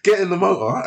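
ordinary delivery

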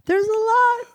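very high squeaky repeat. Pretend. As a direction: squeak it out like a cartoon.

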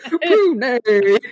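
"pruny" with the "-ny" hit hard and drawn out; stress on second syllable. Retold or imitated. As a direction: punch and stretch the end of "pruny". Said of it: "goes hard on that second syllable".

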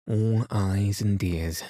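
usual speaking voice

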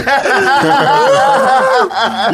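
high shouted protest breaking into laughter; high laughing yell. Real, not acted. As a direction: yell it while cracking up. Recoiling at liposuction.

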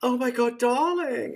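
way up high, swooning over her; gushing fan voice. Retold or imitated. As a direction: gush it like a starstruck fan. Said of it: "but not like".

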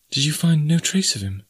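high, concerned questioning; anxious young woman. A narrator's reading. Jane anxiously asks after D'Arnot.

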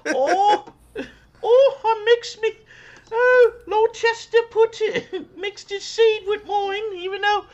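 very high, slow falsetto of a scandalized English lady; falsetto posh lady. Pretend. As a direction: shriek it like a prim old English lady gasping. Mock posh British lady.

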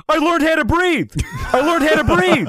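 loud, high-pitched mimicry of euphoric revelation; amazed shout. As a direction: announce it like a tripping man's great breakthrough. Tripping roommate announces his discovery.

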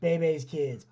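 ordinary delivery

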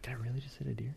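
very soft, stunned whisper; retold hushed, baffled thought; whispered disbelief. Retold disbelieving thought after crash.

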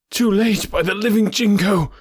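high, forceful cry of despair; anguished shout. A narrator's reading. Rescuer seeing all is lost, high anguished shout.

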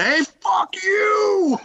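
high, slow, drawn-out mock shout. Guest replays his buddy yelling at the bragging fisherman, slow mock shout. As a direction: stretch the insult out, laughing. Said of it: "screamed at this guy".

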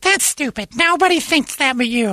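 high squeaky imitation of his girlfriend; mocking girlfriend voice. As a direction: mimic her in a high whiny voice. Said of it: "Megan's like".

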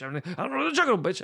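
high, fast, tossed-off Juggernaut line; lightly voiced, quick villain quip; mock Juggernaut line. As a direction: rattle it off like a throwaway. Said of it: "delivered like that".

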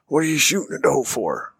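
mock the dad's irritated demand; a hint of an annoyed father telling his son off; angry dad. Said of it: "And my dad was pissed".

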